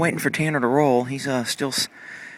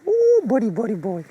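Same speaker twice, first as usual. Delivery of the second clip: much higher, slow squealing ooh; delighted cooing; 10 semitones higher, slower than usual. Real, not acted. Cooing at Buddy as he breathes down her neck, high squeal. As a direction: coo at the horse, tickled.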